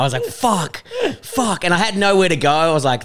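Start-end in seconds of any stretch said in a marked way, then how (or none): 0.2-1.7 high mortified swearing. Retold or imitated. Retold humiliated reaction at pub.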